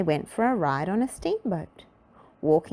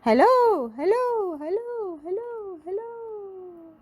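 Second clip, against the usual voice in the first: fading echo; slower than usual. A narrator's reading. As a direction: call hello then let it echo away. Echo in the Grand Canyon.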